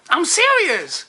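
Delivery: put-on eager girl. Woman answering the guy's question, high and eager.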